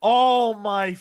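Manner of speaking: loud, high-pitched outburst of disbelief; high, drawn-out exasperation, broken by pauses; exasperated dismay. Real, not acted. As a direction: cry out as if you've just been had. Dismayed at a surprise comic charge.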